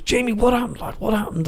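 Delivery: high-pitched imitated questioning; retold incredulous questioning. Friend rings Jamie next day for explanation, high incredulous questioning. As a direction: pitch it right up, pressing for an answer.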